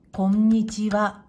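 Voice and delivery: こんにちは in even beats on a level pitch; loud, clear こんにちは with no stressed syllable; same rhythm on every sound. Shown as a demonstration. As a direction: give each mora of こんにちは equal weight. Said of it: "だいたい同じリズムで発音しま".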